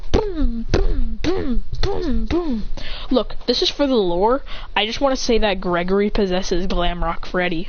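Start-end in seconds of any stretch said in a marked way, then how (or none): 0.1-2.6 imitated booms, each falling in pitch; explosion sounds. A narrator's reading. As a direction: punch out a volley of blasts. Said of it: "brawl, going".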